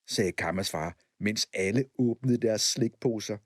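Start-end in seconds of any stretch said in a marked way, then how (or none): none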